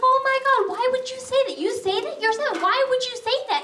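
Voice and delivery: high, appalled questioning; aghast mother. Retold or imitated. Mom reacting to her young son's remark, appalled. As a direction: scold in disbelief, pitch jumping up.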